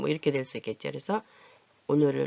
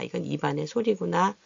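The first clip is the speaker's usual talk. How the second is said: ordinary talk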